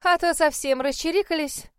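high, loud fussy complaining; weary complaint. Acted. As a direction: complain like a flustered hostess. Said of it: "утомлённо пожаловалась жена мэра".

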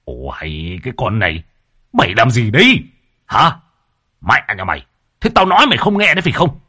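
growling, menacing husband's voice; bully her with rising threat; brutal husband's rage. A narrator's reading. Husband grabs his wife by the collar, menacing and angry. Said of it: "sấn sổ, xông đến hàm hàm".

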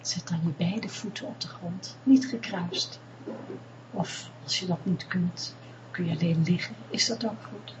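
calm them down gently, close in; guided meditation. Acted. Leads a meditation. (rustige meditatiestem)

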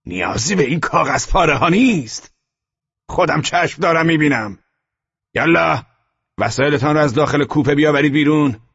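high, loud contemptuous officer; harsh order. A narrator's reading. SS officer dismissing the ID and ordering bags out. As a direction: brush off the papers and bark orders.

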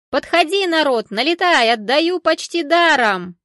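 high, loud call, drawn out at the end; hawker's bawling. A narrator's reading. Greens seller calling people over, loud high bawling. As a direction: summon the whole crowd to your stall. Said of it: "продолжала голосить женщина".

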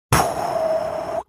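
soft whispered pop; popping sound. Acted. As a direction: puff out a pop. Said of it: "послышался звук".

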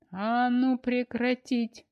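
slow, loud rolling bellow; booming roar. A narrator's reading. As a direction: roar it out to break up the fight. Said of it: "раскатисто взревела тётка".

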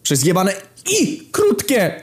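high, slow, furious shouting; furious yell. Retold or imitated. Yelling at the clerk about the misspelled name, furious. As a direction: bellow it at her, spelling the letter out.

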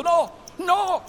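loud, high cry of refusal. A narrator's reading. Cecco on his knees before the captain, crying out. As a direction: cry out high, begging not to go.

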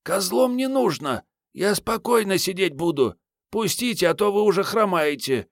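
put-on high, pleading girl voice; young girl voice. A narrator's reading. Girl character voiced by narrator.

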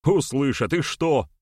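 high, defiant «Услышат», thrown out for all to hear; deliberately loud. A narrator's reading. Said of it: "нарочито громко спросил Берамир". (нарочито громко, с вызовом)

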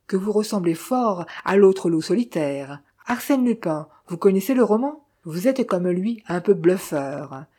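usual speaking voice

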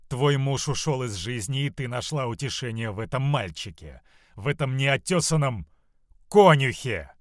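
loud contempt bursting out on the last word; acted outraged disdain; scornful anger. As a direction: accuse bitterly, building to a loud finish. Mother scorns daughter's lover.

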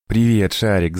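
mild joyful yell; yelling greeting. A narrator's reading. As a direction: call out to a rescuer. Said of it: "заорал Давид". (радостно заорав)

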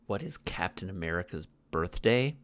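ordinary talk, nothing marked

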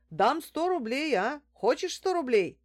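high, loud counter-offer; eager haggling. A narrator's reading. Man haggling over the price. (торгуясь, настойчиво)

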